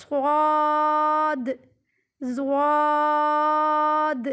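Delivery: صاد and زواد heavy, lips not fully rounded; very slow "swaad", "zwaad" for صاد، زواد, each held; thick, half-rounded letters. Shown as a demonstration. Teacher showing slight lip rounding, thick long صاد، زواد. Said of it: "پورے ہونٹ گول نہیں ہوتے".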